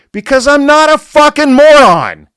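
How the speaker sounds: real exasperated yell, high and very loud; angry shout. Real, not acted. Host ranting at those who label whole groups, furious shouting.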